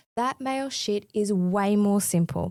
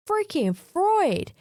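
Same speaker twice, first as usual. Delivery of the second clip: exasperated outburst; 9 semitones higher, slower than usual. Real, not acted.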